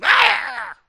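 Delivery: sudden high, loud exasperated ah; frustrated outburst scream. Acted. Stressed person snapping at someone, loud frustrated yell. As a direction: let it all explode at once.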